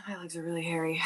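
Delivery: hushed confessional whisper; whispered aside to the chat. Real, not acted. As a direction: breathe it out as a secret aside.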